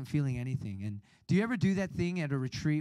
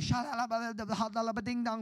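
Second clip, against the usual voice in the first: higher, babbling mock speaking in tongues; 7 semitones higher than usual. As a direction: rattle off gibberish like praying in tongues. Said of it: "they're all praying in tongues".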